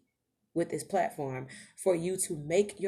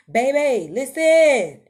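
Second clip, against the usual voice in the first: loud call; 8 semitones higher, 14 dB louder, much slower than usual. Real, not acted. Preacher calling to her community, loud. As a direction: grab them by the collar.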